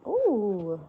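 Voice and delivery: ordinary talk, nothing marked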